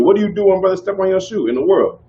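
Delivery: ordinary talk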